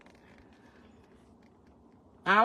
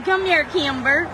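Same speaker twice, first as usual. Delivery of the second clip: higher, louder sing-song call; 6 semitones higher, 6 dB louder than usual. Real, not acted. Woman calls her cat Kimber in baby voice. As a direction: coax the cat by name. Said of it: "I start saying".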